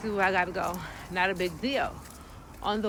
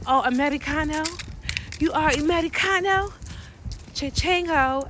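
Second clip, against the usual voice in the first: a touch of a sly local kidnapper; mocking kidnappers; 7 semitones higher than usual. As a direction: tease like a kidnapper spotting a tourist. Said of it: "They will hold you".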